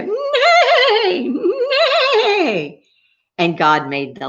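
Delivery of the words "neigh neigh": high, drawn-out imitated whinny; horse neigh. Teacher imitates the horses for children, high long neigh. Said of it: "and they go".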